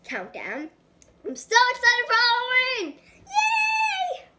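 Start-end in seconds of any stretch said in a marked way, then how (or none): 1.2-4.3 excited. Real, not acted. Kid greets Halloween cooking video.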